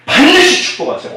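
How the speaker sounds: 반드시 punched high and hard; emphatic proclamation. Real, not acted. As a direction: declare it with a pitch leap on 반드시. Preacher proclaiming blessing. (반드시에 힘을 주어 선포하듯)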